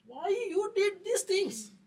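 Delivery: loud, high, drawn-out angry shout; angry father. Retold or imitated. Father confronting his child after a slap, angry shout. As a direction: yell it slowly as an outraged father. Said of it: "father will give a first slap".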